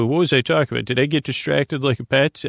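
ordinary talk

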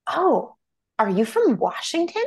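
high rising mock discovery; mock curious surprise. Imagined stranger guessing where the speaker is from, mock curious surprise. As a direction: lightly act out a stranger's sudden guess.

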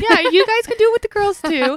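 laughing high and loud. Real, not acted. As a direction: blurt it through laughter. Laughing while joking.

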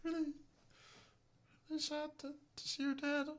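tiny, squeaky-high hushed celebrity impression.

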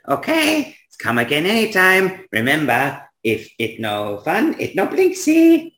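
high singsong catchphrase, leaping up at the end; salesman toymaker Blinksy. Acted. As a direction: sign off like a squeaky jingle. Voices Blinksy, toymaker figure.

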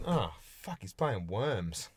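quiet, slow let-down grumble; disappointed mutter. Retold or imitated. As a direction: deflate as the hope dies. Retold letdown at a mate's game.

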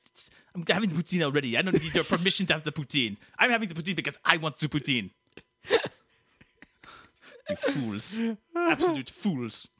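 put-on huffy French-Canadian accent; petulant French-Canadian outburst; Quebecois Dave. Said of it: "Have your poutine".

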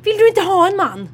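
high, loud mock disbelief; imitated incredulous old lady; shocked. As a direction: exclaim it like a scandalised old lady. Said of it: "hon blev helt chockad".

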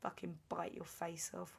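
quiet, restrained threat through gritted teeth. Acted. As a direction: mutter a mock threat, keeping it soft and tight. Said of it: "smiling through gritted teeth".

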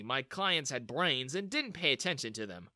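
ordinary delivery, nothing marked

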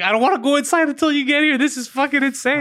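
high-pitched mock panic; imitated alarmed friend. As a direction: act out a friend refusing to walk into a fight. Retells friend's alarmed message from the fight.

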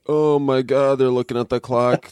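hammed-up afternoon slump; weary clock-watcher. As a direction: act out dreading the hours left until five. Imagined worker in afternoon energy slump.

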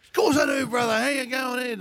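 mimicking a polite bluff; imitating Dean Kemp. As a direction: fake the warm recognition of a stranger. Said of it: "He goes".

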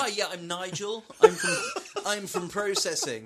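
high-pitched voice laughing through a greeting; hammed-up friendly office worker; chirpy office introduction. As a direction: introduce yourself brightly, half laughing. Voicing man befriending a bear.

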